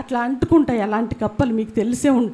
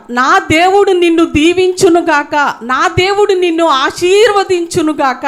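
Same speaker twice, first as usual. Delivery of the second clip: booming, slow preacher's blessing; loud blessing; 4 semitones higher, 7 dB louder than usual. Retold or imitated. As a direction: bless them with full force. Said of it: "నేనంట". (గట్టిగా ఆశీర్వదించడం)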